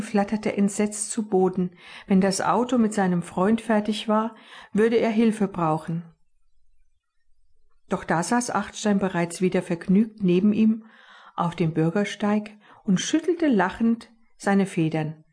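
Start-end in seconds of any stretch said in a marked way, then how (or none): none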